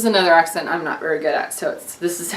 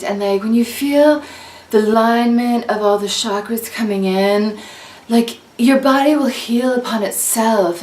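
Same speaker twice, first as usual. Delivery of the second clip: hippie chick. Acted. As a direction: float it out like a blissed-out hippie. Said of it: "This is a hippie chick".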